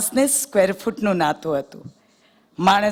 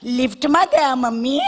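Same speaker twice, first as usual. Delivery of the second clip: put-on high, childish questioning voice; little boy asking; 6 semitones higher than usual. Little boy asks his mummy a silly question, high childlike whine. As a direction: pipe up like a curious little boy. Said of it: "બાબાએ મમ્મીને પૂછ્યું".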